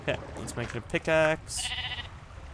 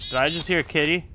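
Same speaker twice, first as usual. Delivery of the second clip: ordinary talk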